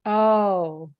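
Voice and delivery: usual speaking voice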